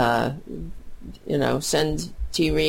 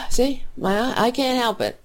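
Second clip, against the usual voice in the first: emotional; 8 semitones higher than usual. Real, not acted. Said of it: "I'm a very emotional person".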